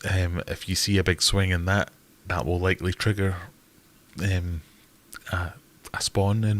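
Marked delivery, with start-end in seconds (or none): none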